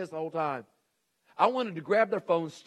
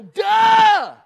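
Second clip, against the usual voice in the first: a much higher, louder mock "Duh!"; 16 dB louder than usual. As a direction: blurt it like it's obvious. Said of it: "And you wanna go".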